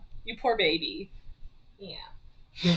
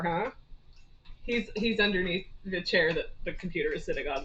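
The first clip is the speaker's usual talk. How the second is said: usual speaking voice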